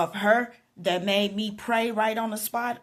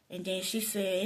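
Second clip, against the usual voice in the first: ordinary delivery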